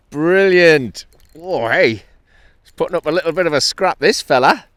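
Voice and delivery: high, loud, thrilled exclaiming; real excitement. Real, not acted. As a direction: exclaim with delight as the fish fights. Thrilled while fighting the fish.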